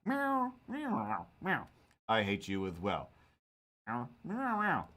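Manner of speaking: a cat butting into the argument; cat meowing. Acted.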